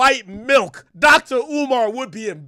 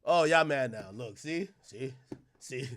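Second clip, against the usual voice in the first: hushed teasing; quiet taunting; 11 semitones lower, 11 dB quieter than usual. Real, not acted. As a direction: taunt under the breath.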